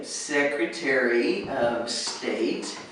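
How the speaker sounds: slow, careful, word by word; slow clear delivery; said slowly. Shown as a demonstration. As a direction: spell it out slowly for the interviewer. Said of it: "So if you say".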